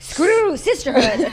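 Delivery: loud, slow, laughing defiance; laughing outburst. Pretend. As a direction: bark it out through a laugh.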